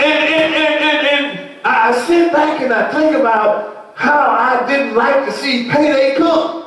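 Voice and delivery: high, ringing preacher's cry, stretched out slow; preaching at full force. Acted. Preacher to his congregation about debt, high preaching wail.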